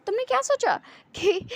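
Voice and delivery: high, loud accusing question; loud, high-pitched outburst; indignant shout. Acted. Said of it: "फिर चिल्ला के कहता है".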